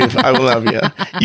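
laughing repeat. Shown as a demonstration. Host asked if he'd be offended, repeats it laughing.

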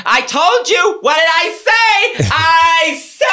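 very high shouted scolding; high hammed-up shout; mock yelling medium. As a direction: yell it like a pushy psychic.

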